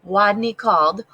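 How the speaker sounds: ordinary delivery, nothing marked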